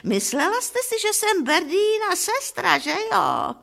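high-pitched squealing character voice; high mock shriek of a delighted chatterer. A narrator's reading. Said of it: "A vříská".

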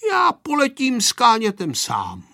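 lightly voiced boy announcing his plan; declaring boy. A narrator's reading. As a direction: announce it as a little boy would. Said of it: "prohlásil Pepíček".

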